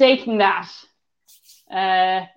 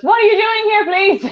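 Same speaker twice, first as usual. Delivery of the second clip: put-on little boy; 11 semitones higher than usual. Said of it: "he goes".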